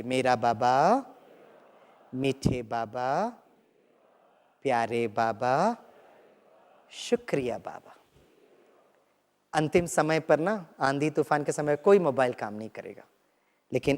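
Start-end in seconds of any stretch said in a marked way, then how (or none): 0.0-7.9 slow, tender and softening; loving call. Shown as a demonstration. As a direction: murmur each name of Baba lovingly, slowly. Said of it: "अभी बहुत प्यार से कहिए". (बहुत प्यार से, धीरे-धीरे)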